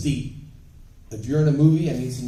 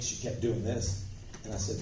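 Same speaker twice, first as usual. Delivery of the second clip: ordinary talk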